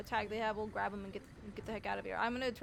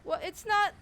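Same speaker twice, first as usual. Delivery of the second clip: angry retort; 11 semitones higher, 10 dB louder, slower than usual. Acted. Arguing with officer.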